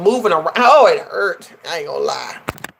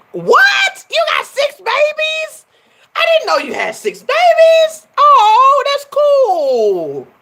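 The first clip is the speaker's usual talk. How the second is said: very high, loud squeal of surprise; shocked squeal at the news; surprised; 4 dB louder, much slower than usual. Real, not acted. Vlogger reacting to a viewer with six kids, astonished.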